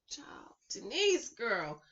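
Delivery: slow, whispered aside; gossipy whisper. Real, not acted. Gossiping about Denise's sitting, whispered.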